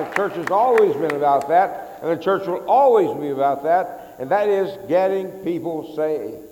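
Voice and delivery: loud preaching at full force; full-voiced pulpit declaration. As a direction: preach it out to the congregation.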